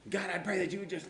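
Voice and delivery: high-pitched prayer cut short; straining to pray. Shown as a demonstration. Pastor re-enacting failed prayer, straining and stuck. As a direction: force it out until it sticks. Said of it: "I just could not get it out".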